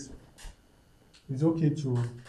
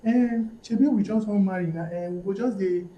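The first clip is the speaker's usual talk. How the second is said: lively, higher-pitched mock reasoning; higher quoted inner voice, lightly hammed up; acted self-persuasion; 6 semitones higher, 4 dB louder than usual. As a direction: voice a guy talking himself into it. Acting out a man talking himself round.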